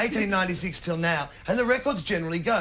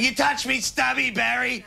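mock aggro bloke; 6 semitones higher than usual. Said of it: "No one's sort of going".

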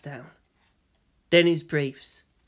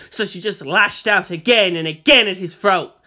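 usual speaking voice

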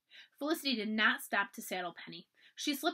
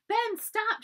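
much higher, louder call across the woods; crying out; 12 semitones higher, 7 dB louder than usual. A narrator's reading. Felicity calls to Ben in the woods, loud high cry. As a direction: shout to stop him running. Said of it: "she cried".

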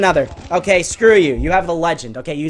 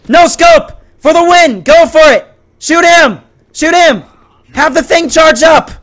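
high, loud gamer shouting; frantic urging; 12 semitones higher, 10 dB louder, slower than usual. Real, not acted. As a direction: yell for the winning shot. Urging the winning shot.

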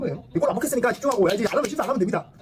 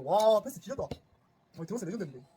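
usual speaking voice

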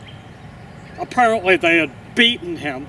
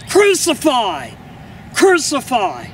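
crowd crying out; 5 semitones higher than usual. A narrator's reading. The crowd drowning out a protest, crying out. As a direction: cry it out over the objection.

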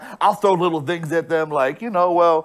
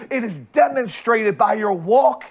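ordinary delivery

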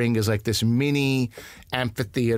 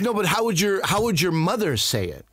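insistent boss; 6 semitones higher than usual. Retold or imitated. Imitates bosses pressing him about name.

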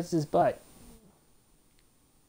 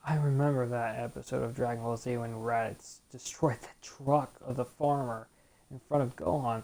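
ordinary delivery

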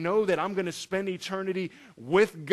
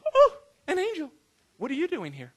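high airy "oh" dropping to casual; comically understated startle, starting very high; mock mild surprise; 7 semitones higher than usual. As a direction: play an unbothered greeting to an unexpected visitor. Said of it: "she's not just".

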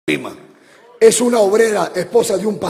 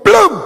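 high, loud mimicked impact; loud crash noise; 11 semitones higher, 9 dB louder than usual. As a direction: smack out the sound of the crash. Imitates the bus hitting them. (imitación fuerte de un golpe)

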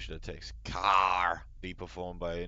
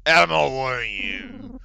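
loud tough-guy bark; gruff villain; 3 semitones higher, 12 dB louder, slower than usual. Acted. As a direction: bark it like a thug. Voicing enemy dialogue.

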